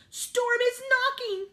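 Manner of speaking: high, loud, slow wail; frightened cry. A narrator's reading. Sheep hearing tapping on the roof, crying out. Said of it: "the sheep cried".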